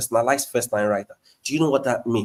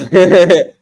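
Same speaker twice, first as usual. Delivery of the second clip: laugh; 5 semitones higher, 10 dB louder than usual. Real, not acted. Laughs at a paying question.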